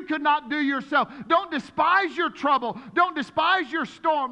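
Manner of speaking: building, slow and emphatic preaching; rising preaching fervor. Acted. Pastor urging the congregation not to despise trouble, fervent preaching.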